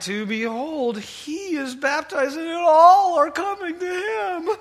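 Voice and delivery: high, loud, whining complaint; petulant high whine of disciples complaining to their teacher; whiny voice. Shown as a demonstration. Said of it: "you just have to read this in a whiny voice".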